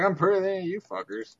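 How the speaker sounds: preening taunt at the group; cocky boast. Acted. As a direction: brag like you own the room. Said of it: "gonna be like".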